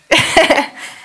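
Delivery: breathy embarrassed laugh through tears; choked-up reader laughs at her own tears; teary laugh. Real, not acted. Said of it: "a bit emotional there".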